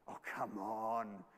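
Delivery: low, drawn-out taunt; Elijah. Acted. Elijah jeering at Baal's silence, slow low taunt. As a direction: groan it slowly in mockery. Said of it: "here is Elijah taunting him".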